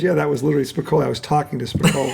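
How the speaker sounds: low, quiet, fast mumbled stoner voice; Sean Penn impression. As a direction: slur it out fast and low like a burned-out surfer. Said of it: "the way he said it was".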